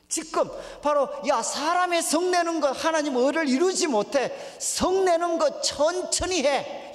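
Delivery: high, loud pulpit exhortation; full-force preaching. Acted. Preacher relaying James's challenge on anger, full-force preaching. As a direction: drive the verse home, drawing out key words.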